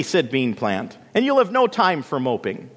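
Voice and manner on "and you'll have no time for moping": higher-pitched big-sister scolding; bossy scolding. A narrator's reading. Bean Plant tells moping Lily off, bossy. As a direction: lecture her, pitched up.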